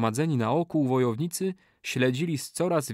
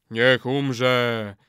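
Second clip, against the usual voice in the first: chorus response; 4 dB louder than usual. A narrator's reading. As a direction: answer the curse in unison. Warriors echo in lower voice.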